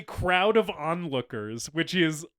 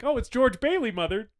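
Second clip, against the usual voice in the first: a lightly higher, sweet daughter voice; Mary answering; 8 semitones higher, 3 dB louder than usual. Retold or imitated. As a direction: answer your mother innocently. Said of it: "And she's like".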